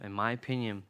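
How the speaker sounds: ordinary talk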